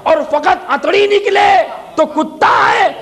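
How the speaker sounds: peak the voice for the final answer; preaching at full force. Acted. Climax preached loud.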